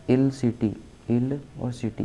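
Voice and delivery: "इल city" split as two separate words, "इल" and "city"; broken into two words. Shown as a demonstration. Teacher breaks illicit up for memory, "इल" and "city" said separately. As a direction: set "इल" apart from "city", each said distinctly. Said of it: "इसे ऐसे तोड़ दो".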